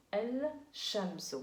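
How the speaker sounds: Al-shamsu drawn out slowly, sh doubled, no L; slow model word. Shown as a demonstration. Teacher checks understanding and repeats the word slowly. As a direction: stretch Al-shamsu out for the class to copy.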